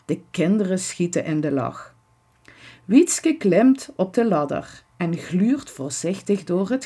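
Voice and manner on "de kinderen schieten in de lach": ordinary delivery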